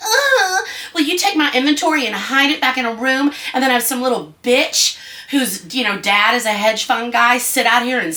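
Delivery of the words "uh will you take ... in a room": mock pleading artist. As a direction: imitate a meek artist begging a gallery, high and overdone. Mocking artists begging galleries.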